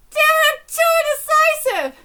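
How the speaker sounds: high, loud cry of frustration; exasperated shout, pitch way up; frustrated outburst. Acted. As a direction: burst out at yourself. Outburst while reading manga aloud.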